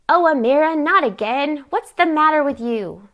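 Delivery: loud, high outburst of exasperation; exasperated scolding. A narrator's reading. Aunt catches niece giving money away, exasperated. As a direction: scold her as if at the end of your patience. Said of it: "always a reason for her to be mad at me".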